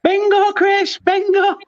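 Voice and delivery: bingo shout. Pretend. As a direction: call out bingo like you've won.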